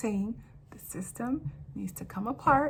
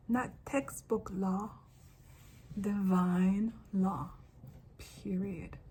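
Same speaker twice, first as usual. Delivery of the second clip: measured words with weighty pauses; slow, deliberate; 3 semitones lower, 5 dB quieter, slower than usual. Real, not acted. Declaring divine law over textbook law, slow and deliberate. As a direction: drop to a slow, final pronouncement.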